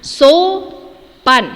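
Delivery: Sopan said loudly and clearly as the model; teacher modelling the word Sopan. Shown as a demonstration. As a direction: say Sopan loudly for the class to copy. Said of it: "kita perhatikan pengucapannya".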